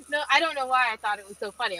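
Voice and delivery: ordinary delivery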